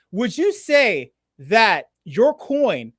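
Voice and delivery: stretched-out slow stalling question; deliberately slow. Real, not acted. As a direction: drag each word out to stall for time. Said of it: "obviously I'm speaking very slowly right now".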